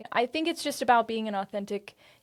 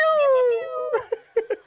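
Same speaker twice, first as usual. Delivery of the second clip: laser pew sounds; 9 dB louder, much slower than usual. Pretend. Co-host joins sound effects.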